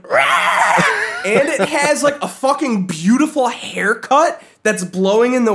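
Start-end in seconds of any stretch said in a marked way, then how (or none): 0.1-0.7 high mock monster roar; horse roar. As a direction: roar like a rearing beast. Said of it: "standing up on its back legs going".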